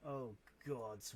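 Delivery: usual speaking voice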